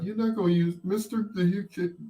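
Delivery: high, hopeful ask; eager kid. Retold or imitated. Kid asking a neighbour for his turntable, high and hopeful. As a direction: wheedle for the gadget. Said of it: "It's like".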